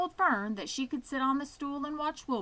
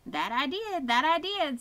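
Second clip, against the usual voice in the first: higher goose, doubled answer, a touch louder; a smug goose confirming twice; goose repeating; 6 semitones higher, 5 dB louder than usual. Acted. Goose confirms the loose board, higher doubled reply. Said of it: "who talked rather fast".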